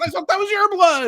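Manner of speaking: mock bewildered reply; husband confused, asking wife about blood. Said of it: "I said".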